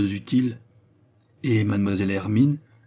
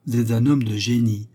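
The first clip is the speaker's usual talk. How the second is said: plain reading voice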